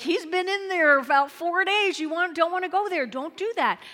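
high, loud pleading warning; warning protest. Acted. Mourners warning Jesus off the four-day tomb. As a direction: beg him not to go. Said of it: "You, like".

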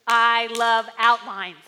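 loud, slow, emphatic repeat. Acted. Pastor stressing his love of outlines, loud. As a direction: lean into every word.

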